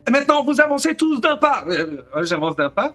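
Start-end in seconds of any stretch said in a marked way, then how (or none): 0.1-1.6 ordre crié. Retold or imitated. Flic donne des ordres.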